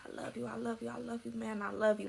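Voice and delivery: ordinary delivery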